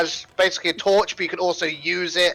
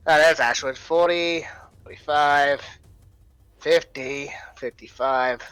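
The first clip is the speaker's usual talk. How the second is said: ordinary talk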